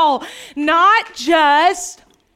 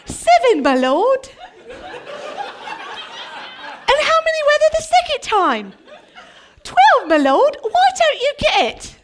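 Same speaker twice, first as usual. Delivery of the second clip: put-on high, theatrical English accent; lilting, high-pitched English accent parody; mock English accent; 10 semitones higher than usual. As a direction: play a Bible-movie scene in hammed-up English. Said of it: "didn't say it in an English accent".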